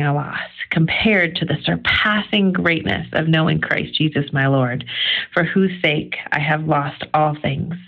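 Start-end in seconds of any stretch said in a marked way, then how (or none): none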